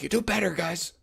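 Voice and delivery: mock scolding, pitched high; high-pitched imitation of a teammate's yelling; Ninja. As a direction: imitate a nagging yell without real volume. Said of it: "it was Ninja yelling at me".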